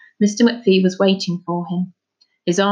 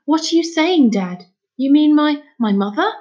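high, louder, halting question; astonished boy; 7 semitones higher, 5 dB louder than usual. Acted. Son asking his father about his mother, astonished. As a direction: stumble onto the word in disbelief.

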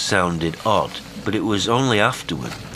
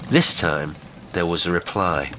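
plain reading voice

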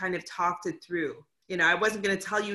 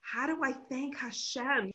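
soft, slightly raised, awed retelling; imitating emotional voice; 3 semitones higher, 5 dB quieter than usual. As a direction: quote a hushed, overwhelmed plea from the mountaintop. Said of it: "your voice was shaking with emotion".

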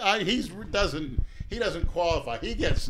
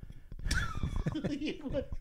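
high laugh; 10 dB quieter than usual. Real, not acted. Reacting to a joke.

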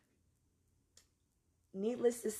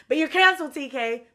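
higher and louder, playful declaration; mock verdict; 4 semitones higher, 6 dB louder than usual. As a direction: pronounce the sentence with mock drama.

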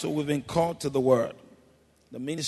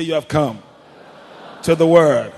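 much louder, full preacher's shout; preach it loud; 13 dB louder than usual. Shown as a demonstration. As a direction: belt it out like a preacher. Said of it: "Come on, preach it. Be a good preacher".

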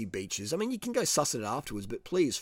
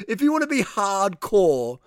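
louder, slow words through a laugh; 7 semitones higher, 8 dB louder, slower than usual. Real, not acted. Vlogger dares viewers to go hardcore, loud and laughing. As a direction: push it out while still laughing.

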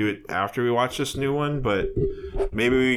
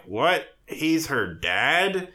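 slow, higher-pitched disbelief; mock shock; 3 semitones higher, much slower than usual. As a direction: stretch it out in hammed-up astonishment. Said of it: "where you're like".

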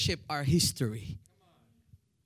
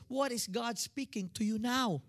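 normal talking voice

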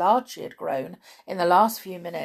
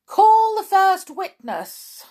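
slow, loud, high summons; king's command; 13 semitones higher, 12 dB louder, slower than usual. A narrator's reading. King calling for the first witness, loud slow command. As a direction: order it grandly, stretched out. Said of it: "said the king".

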